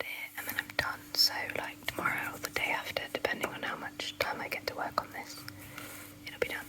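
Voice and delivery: soft late-night whisper. Real, not acted. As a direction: whisper so nobody wakes. Late-night whispering, quiet house.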